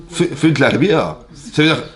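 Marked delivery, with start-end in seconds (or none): none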